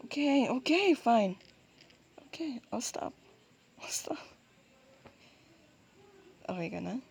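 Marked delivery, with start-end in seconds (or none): none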